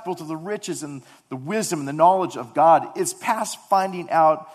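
loud, high, impassioned sermon voice; fervent preaching. Acted. Pastor preaching to his church, loud and fervent. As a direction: preach it out to the congregation.

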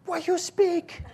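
squeaky-high mock Chinese accent; very high Chinese-accented question. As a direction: imitate a baffled Chinese lady. Imitates Chinese lady questioning him.

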